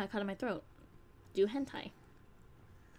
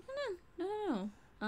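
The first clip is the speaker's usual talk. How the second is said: high, soft run of no's; mock denial; 12 semitones higher, 4 dB quieter than usual. Creator answering surprised fans, high soft playful denial. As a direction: pitch it way up and softly wave it off.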